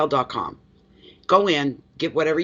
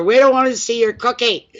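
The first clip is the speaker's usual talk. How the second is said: joking quote; 8 semitones higher, 6 dB louder than usual. Retold or imitated. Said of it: "I told her".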